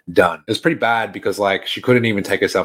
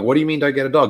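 higher-pitched, puzzled challenge; retold incredulous question; 6 semitones higher, faster than usual. Grandson questioning grandma's no-dog rule, mock incredulity. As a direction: imitate questioning an absurd rule.

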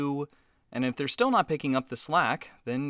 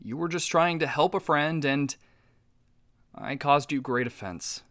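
usual speaking voice

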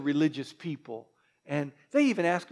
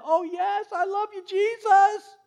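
much higher, swooning praise; gushing worshipper; 12 semitones higher than usual. Retold or imitated. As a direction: overdo Sunday emotion in mockery. Churchgoer gushing on Sunday.

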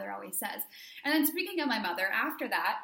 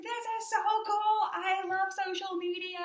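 high, gushing delight; breathless high thrill; geeking out; 9 semitones higher, 4 dB louder than usual. Retold or imitated. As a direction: squeal it like you just met a fan. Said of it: "I was, like, geeking out".

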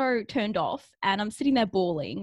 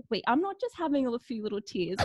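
ordinary talk, nothing marked

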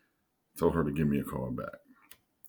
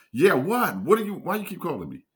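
higher, louder fed-up retort; exasperated; 14 semitones higher, 5 dB louder than usual. Retold or imitated. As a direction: snap back, tired of being called. His annoyed retort to Lisa.

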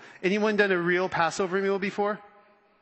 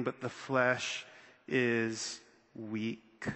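The spirit indeed is willing, but the flesh is weak said slowly and much softer, trailing off low; slow hushed repeat; 6 semitones lower, 7 dB quieter, much slower than usual. Shown as a demonstration. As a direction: let it sink in, slow and quiet. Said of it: "say it with me".

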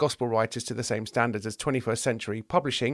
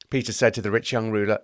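plain reading voice

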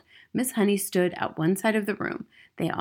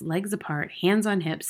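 plain reading voice

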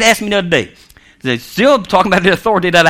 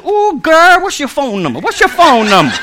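high swoop on the opening, mock smitten; hammed-up flirty excitement; playful flirting; 7 semitones higher than usual.

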